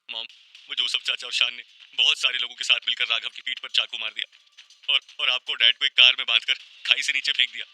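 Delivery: frightened, sustained near-crying wail; tearful voice. A narrator's reading. Tanya recounting her nightmare to mom, tearful. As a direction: pour out the nightmare, close to sobbing. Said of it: "Tanya ने रुआंसी आवाज में कहा".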